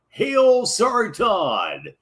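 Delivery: loud, drawn-out hail; mock salute. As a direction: proclaim it slowly like a grand salute. Host performs a salute for a gag.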